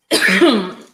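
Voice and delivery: real loud, hard cough; choking cough. Real, not acted. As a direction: cough hard to clear a choke. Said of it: "about to choke over here".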